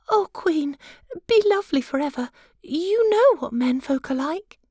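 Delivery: high, light wife's voice; high pitched fussy little wife; butterfly wife. Acted. Wife speaks to the queen.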